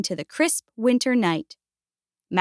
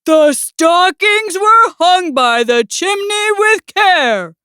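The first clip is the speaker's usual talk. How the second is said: slow, higher, emphatic recitation; loud recital; 6 semitones higher, 4 dB louder, slower than usual. A narrator's reading. Bear hints at the stockings to Mouse, loud recital. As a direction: recite it pointedly, raising the voice. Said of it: "repeated more loudly".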